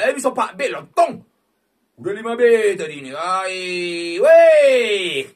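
fast, loud rising cry; excited. Real, not acted. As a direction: rush out the excitement. Excited reaction to five B.

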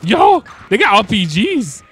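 high loud alarmed yell; alarmed shout. Real, not acted. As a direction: cry out in alarm at the danger. Sees enemies with rockets.

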